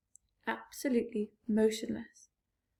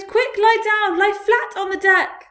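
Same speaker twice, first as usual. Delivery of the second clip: 1 urgent shout; 10 semitones higher, 12 dB louder than usual. A narrator's reading. James urges everyone down.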